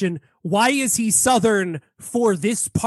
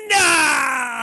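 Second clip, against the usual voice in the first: higher, drawn-out mock scream; imitated cry; 6 semitones higher than usual. Retelling Zhu Yan running into the scene, mock scream. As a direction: wail it out as a movie character's cry. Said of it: "runs in and goes".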